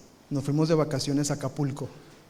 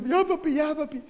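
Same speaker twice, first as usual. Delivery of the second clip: put-on pleading kids; 10 semitones higher than usual. Kids calming dad.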